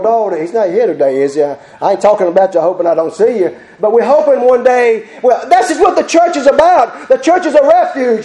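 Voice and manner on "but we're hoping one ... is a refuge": high, raised preacher's voice; full-force preaching. Acted. Preacher urging church to help the needy, full-force preaching. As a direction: preach it out, pitched high and urgent.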